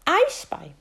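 put-on high, loud child's voice; little girl voice. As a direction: ask like a worried small girl.

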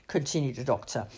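ordinary delivery

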